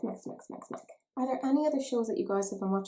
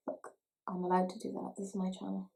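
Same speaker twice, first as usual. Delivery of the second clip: ordinary delivery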